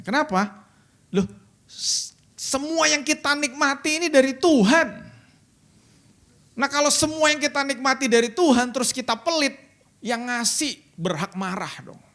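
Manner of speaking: high and loud, building to a peak; fiery preaching. Acted. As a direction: drive the point home hard. Preaching at full force.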